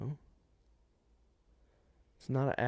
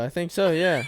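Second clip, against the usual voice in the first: laughing; 7 semitones higher, 6 dB louder than usual. Real, not acted. Laughing along about Betsy Ross.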